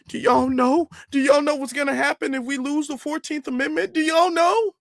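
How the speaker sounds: lightly hammed alarmist question; mocking their warning. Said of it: "when they said the whole thing".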